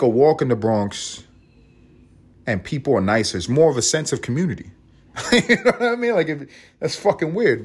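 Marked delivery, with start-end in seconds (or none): 5.3-7.6 high laughing aside; giggling self-aware aside. Real, not acted. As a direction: laugh through the words at yourself. Laughing at himself.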